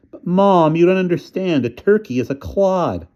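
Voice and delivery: put-on boy arguing with his mother; whiny protest. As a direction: plead with mom, exasperated.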